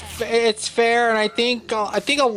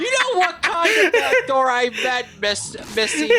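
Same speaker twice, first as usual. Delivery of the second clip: laughing; 12 semitones higher than usual. Real, not acted. Teasing friend over innuendo.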